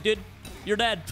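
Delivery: ordinary talk, nothing marked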